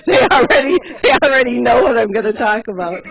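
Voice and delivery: laughing through the words. Real, not acted. Speaker laughing at colleagues who know the story.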